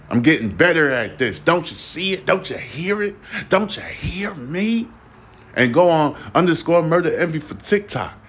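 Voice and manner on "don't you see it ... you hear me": rising mock plea. Mock pleading to listeners.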